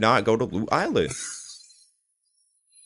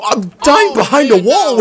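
high, very loud, drawn-out complaint; stretched-out gamer shout; outraged yell; 11 semitones higher, 9 dB louder, slower than usual. Real, not acted. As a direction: yell it slowly in disbelief. Dying in the game.

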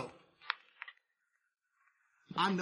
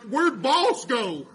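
mock employee; 6 semitones higher, 9 dB louder, much slower than usual. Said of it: "Tannehill gonna be like".

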